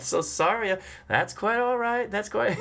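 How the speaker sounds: high, drawn-out Southern gentleman's reply; slow Southern drawl. Retold or imitated. Driver answering the sleepy passenger, slow Southern drawl. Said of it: "had the greatest Southern accent, this, this lovely, mellifluous, kind of slow drawl".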